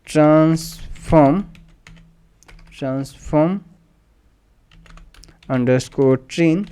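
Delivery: slow, word-by-word dictation; typing-along dictation. Real, not acted. Teacher typing a variable name for students, slowly dictating. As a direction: draw each word out as you type it.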